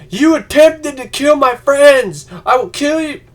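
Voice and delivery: high, loud, slow hammed-up threat; mock crazed fury. Imitating Spider-Man vowing revenge on Goblin, hammed-up fury. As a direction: ham up a hero losing it in rage. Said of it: "he was apeshit crazy".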